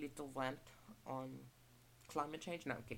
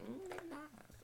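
high soft voice; 6 dB quieter, slower than usual. Retold or imitated. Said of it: "I'm like".

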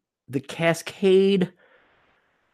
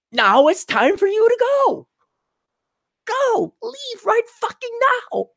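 mock impatient shout. Voicing impatient self.

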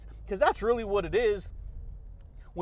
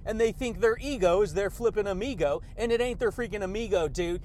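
ordinary talk

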